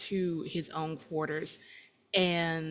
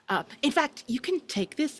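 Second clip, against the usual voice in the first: high, louder sudden offer; pitched-up youthful eagerness; eager young man; 9 semitones higher, 5 dB louder than usual. Acted. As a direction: press a gift on her to send her off. Young man hurrying woman away.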